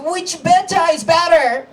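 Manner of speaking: high, loud question mimicking both accents; baffled comeback. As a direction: ask loudly, in exaggerated confusion. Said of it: "So I was like".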